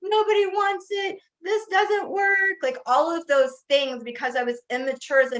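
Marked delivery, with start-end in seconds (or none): 0.0-2.6 retold tearful wail. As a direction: wail it like the tears she cried back then. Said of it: "Like, I cried".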